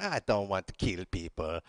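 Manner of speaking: low, soft mock Italian voice; mock Italian accent. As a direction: play a reluctant Italian, hint of an accent. Acting out reluctant Italians answering Germans.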